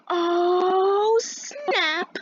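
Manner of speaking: high, long drawn-out "oh" of a taunting crowd; mimicked class reaction. Whole class reacting to a comeback, high drawn-out mimicry. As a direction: stretch it out high like a class egging someone on.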